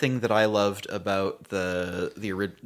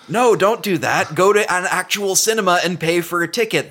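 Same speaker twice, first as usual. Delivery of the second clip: raised, louder insistent plea; emphatic urging; 8 semitones higher, 5 dB louder than usual. Real, not acted. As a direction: cut in high and loud, urging them. Pleads with listeners against bedsheet viewing.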